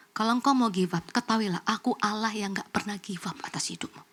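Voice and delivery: low, hushed promise, close to a whisper; God's quiet assurance. Retold or imitated. Preacher voices God speaking to discouraged parent, hushed assurance. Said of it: "Tuhan tuh ngomong begini".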